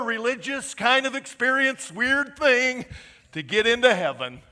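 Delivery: loud, high, fiery preaching; preaching at full force. Real, not acted. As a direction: shout it out to the congregation.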